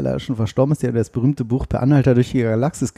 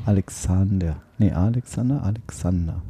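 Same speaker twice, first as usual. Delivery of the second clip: "Alexander" stressed on the third syllable, a-lek-SAN-der; "Alexander" repeated thoughtfully with weight on SAN; stress demonstration; 5 semitones lower, 5 dB quieter than usual. Shown as a demonstration. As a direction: test the stress of "Alexander", low and soft. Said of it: "Auf der dritten Silbe betonen wir meist".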